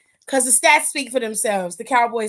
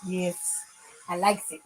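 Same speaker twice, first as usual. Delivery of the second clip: ordinary delivery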